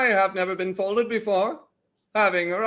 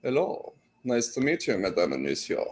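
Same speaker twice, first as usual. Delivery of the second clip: very low, mock French greeting; soft, deep put-on French accent; French accent practice; 11 semitones lower, 5 dB quieter than usual. Practising accents alone before class, low mock French accent. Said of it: "practice my range of accents".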